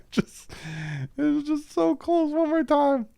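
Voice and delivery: high breathless laughing through the words; real laughter, voice cracking high; pained laughter. Real, not acted. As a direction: laugh in disbelief at the near miss. Agonized laugh at near pick six.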